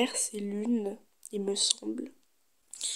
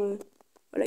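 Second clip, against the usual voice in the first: ordinary talk, nothing marked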